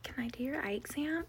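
soft whispered ASMR greeting. Retold or imitated. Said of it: "They're like".

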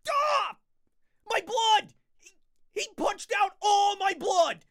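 high, loud mock cry; hammed-up wail. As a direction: howl in fake agony.

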